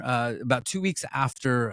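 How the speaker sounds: usual speaking voice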